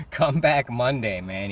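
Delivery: enthusiastic invitation. Retold or imitated. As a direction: imitate a boss who is sold on the new guy. Boss hiring him on the spot.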